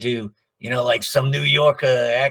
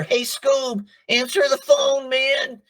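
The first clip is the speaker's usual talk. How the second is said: much higher slacker cartoon voice; lazy stoner buddy calling out, pitched way up; Shaggy impression; 14 semitones higher than usual. Shown as a demonstration. Answering machine gag for a Canadian client, high Shaggy voice. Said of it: "Shaggy and Scooby".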